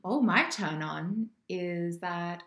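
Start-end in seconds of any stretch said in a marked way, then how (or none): none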